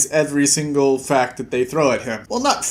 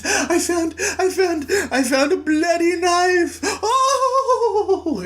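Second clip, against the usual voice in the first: much higher, wailing panicked lady voice; hammed-up shrieking distress over a knife; hysterical old lady; 13 semitones higher, slower than usual. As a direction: Missus Hubbard bursting in, mock-hysterical falsetto wail. Mrs Hubbard runs in hysterical.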